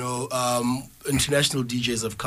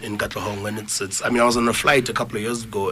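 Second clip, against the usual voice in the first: normal talking voice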